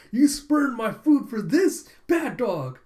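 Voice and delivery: high, loud mock fury; hammed-up angry yell, drawn out; Yor, mad. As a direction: scold the dog in melodramatic outrage. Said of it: "imagines Yor getting mad".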